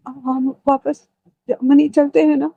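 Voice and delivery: high, tearful begging broken by sobs; frantic weeping wife begging to go back to Germany; crying plea. Acted. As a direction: plead through tears, stammering and voice cracking high. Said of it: "Sanaya रोते हुए कहती है".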